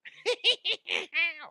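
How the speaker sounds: high giggling laugh in the put-on voice; squeaky laugh. Comedian finishing the [name] bit, high giggle. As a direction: giggle high like the party guest. After mock [name] line.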